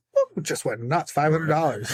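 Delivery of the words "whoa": high shriek of surprise; joyful whoa. Retold or imitated. Hearing his team win People's Choice, high excited whoa. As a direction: let it fly up sky-high in joy. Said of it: "And I'm like".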